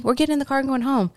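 put-on grandma hurrying the child toward home; brisk wrap-up. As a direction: rattle it off to end the debate. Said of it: "and she says".

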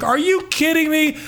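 high, indignant mock-outrage; outraged barista rant. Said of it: "I'm so, I'm so inflamed".

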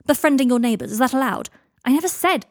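indignant quarrel. Acted. Draco and Harry arguing over brownies, indignant. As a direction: throw it out as a loud challenge. Acted quarrel, two characters trading retorts.

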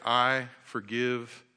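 very slow and deliberate; slow modelled forgiveness. Shown as a demonstration. Pastor leads the congregation in forgiving, slowly. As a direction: draw out each word so they can repeat it. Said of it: "And I want you to say".

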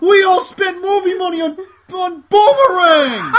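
loud, high, laughing yell; laughing shout. Retold or imitated. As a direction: shout it while cracking up. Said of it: "He goes".

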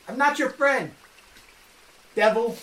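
sharp, high accusing shout; angry accusation. Acted. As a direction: accuse him, furious. DM voices prince accusing Marcus.

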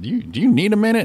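high, pointed mock-polite question; sarcastic question. To a grumpy clerk, a high mock-polite jab. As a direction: needle her with fake concern. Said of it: "And I said".

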